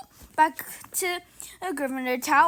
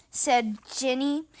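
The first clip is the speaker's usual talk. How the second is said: usual speaking voice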